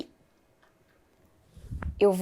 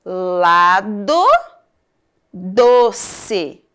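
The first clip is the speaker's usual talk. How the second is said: LADO and DOCE split into LA-DO, DO-CE; syllable by syllable; 3 dB louder, much slower than usual. Shown as a demonstration. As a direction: sound out lado, doce for learners. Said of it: "Vou falar de novo".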